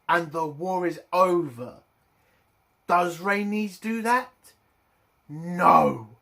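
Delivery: loud, exasperated ranting at the show; furious rant. Real, not acted. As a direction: vent real outrage at a stupid decision. Furious rant about the scene.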